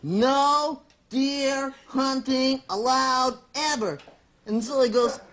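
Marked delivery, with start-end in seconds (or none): 0.0-4.0 very slow, word by word; reading a sign. Acted. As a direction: read it out laboriously with long gaps. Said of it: "he reads it and it says".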